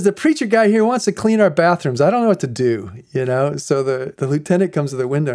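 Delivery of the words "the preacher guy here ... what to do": raised voice, passing the problem up; imitated flustered officer. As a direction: report helplessly to the boss. Officer reports baffled to his boss.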